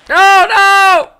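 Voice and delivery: loud, high no-no; dismayed shout. Real, not acted. Gamer missing the jump, dismayed shout. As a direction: yell it as you fail.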